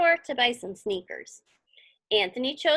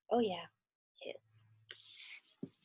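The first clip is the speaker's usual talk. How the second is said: hushed aside, voice dropped to a whisper; soft whispered afterthought to the listener; 7 semitones lower, 14 dB quieter, much slower than usual. Real, not acted. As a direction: whisper it as a quick correction.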